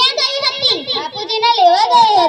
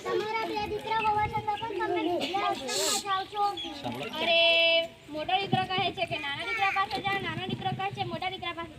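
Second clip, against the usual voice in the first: put-on soft, quick, lowered old father; lonely father's lament; 14 dB quieter than usual. Neglected father grumbling to himself, soft and hurt. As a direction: mutter it to yourself, hurt.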